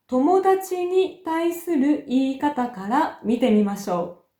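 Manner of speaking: usual speaking voice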